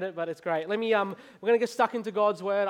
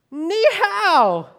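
loud, high call of a shopkeeper greeting tourists; exaggerated high, loud mock greeting; hearty hailing; 12 semitones higher, 12 dB louder than usual. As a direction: belt out a drawn-out greeting, pitched way up. Shop owner hails him, retold.

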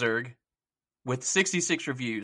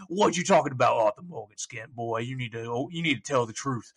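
a hint of stern cowboy questioning; Arthur Morgan voice. Voice actor as outlaw grilling a kid, a hint of gruff cowboy. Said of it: "a spot on Arthur Morgan impression".

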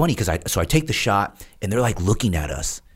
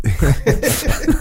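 laugh; 12 semitones higher, 6 dB louder than usual. Real, not acted. Laughing at the dip offer.

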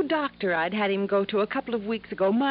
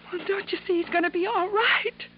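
higher, crying with relief; tearful relief; 7 semitones higher than usual. Acted. Ginny tells Johnny her uncle will live, crying with relief. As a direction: speak through happy tears.

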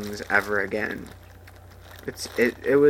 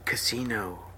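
whispered ad word; 6 dB quieter than usual. Retold or imitated. Mocking the casino ad, whispered. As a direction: breathe it like a seductive ad. Parodying the ad.

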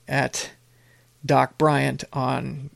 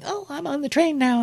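much higher, sing-song mock of a grateful fan; mocking gushing follower; 12 semitones higher than usual. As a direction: parody the sugary thank-you reply in a high voice. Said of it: "responses of people going".

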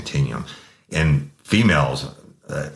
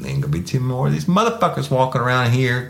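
higher-pitched mocking taunt; 10 semitones higher than usual. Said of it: "And I'm like".

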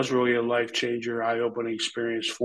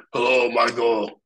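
wrestler's greeting; 2 semitones lower, 5 dB louder, slower than usual. Retold or imitated. Wrestler greets his friend's young son, booming and slow. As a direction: boom out a drawn-out hello.

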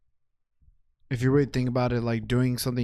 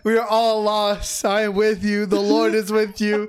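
loud, high revival preacher; loud, slow mock sermon; 7 semitones higher, 6 dB louder, slower than usual. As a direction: ham up a fiery sermon. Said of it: "you're sounding like a preach now".

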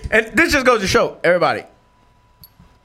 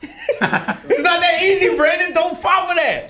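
laughing; 9 semitones higher, slower than usual. Real, not acted. Laughing warning to friend.

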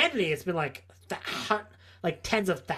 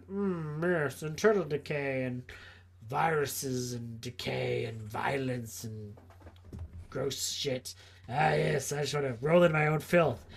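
slower, softer relish in the decay; a hint of a languid servant of filth; lightly voiced plague cultist; 5 semitones lower, 6 dB quieter than usual. Voices Nurgle's Death Guard reveling in decay.

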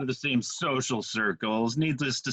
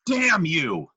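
louder dramatic curse; angry shout; 5 semitones higher, 5 dB louder than usual. Pretend. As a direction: shout it at the sky. Cursing Steve again.

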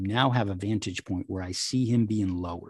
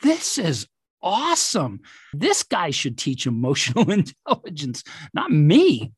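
amazed; 9 semitones higher, 4 dB louder than usual. Retold or imitated. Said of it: "And I said".

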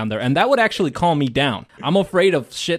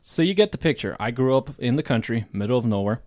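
ordinary delivery

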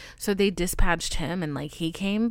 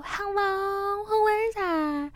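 high, slow mock calling out; lightly voiced calling into the dark; 12 semitones higher, slower than usual. Caller pretending to be brave, high mock call. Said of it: "I didn't go".

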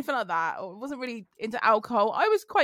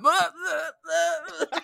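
high, mock blubbering nonsense; dad falling apart; 5 semitones higher, much slower than usual. Dad seeing the wound, mock blubbering. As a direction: imitate a dad going to pieces. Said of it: "he's like".

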